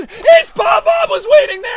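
shocked shout. Acted. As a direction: scream it like the ambush caught you. Surprise attack at ringside.

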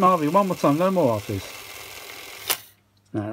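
ordinary delivery

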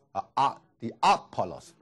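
ordinary talk, nothing marked

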